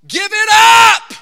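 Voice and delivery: huge, high, drawn-out shout; preacher's shout. Acted. Preacher giving runaways his pastoral advice, full-throated shout. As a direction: bellow it slowly at the congregation.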